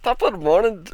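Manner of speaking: half-hearted Irish accent try; attempted Irish accent. Shown as a demonstration. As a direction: start a shaky Irish accent, then abandon it. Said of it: "comfortable saying in an Irish accent".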